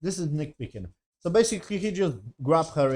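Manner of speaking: ordinary talk, nothing marked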